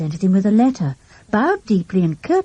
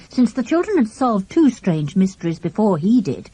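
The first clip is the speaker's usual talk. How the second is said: ordinary delivery, nothing marked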